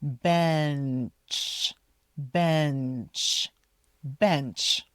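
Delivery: bench with the N sounded; bench, hold the N before the CH; clear N. Shown as a demonstration. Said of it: "Make sure you say the N".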